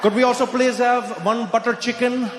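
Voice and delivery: a hint of Indian accent, hammed-up; mock confident Indian order. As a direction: announce the order proudly to the waiter. Showing off ordering Indian food.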